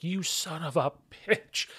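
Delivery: usual speaking voice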